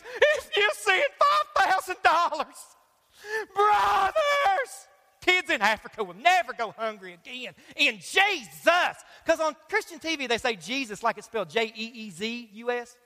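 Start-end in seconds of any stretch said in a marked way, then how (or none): 0.0-8.9 TV preacher parody. Retold or imitated. TV preacher pleading to the camera for cash, slow booming parody. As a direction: belt it like a greedy TV preacher begging. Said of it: "He says".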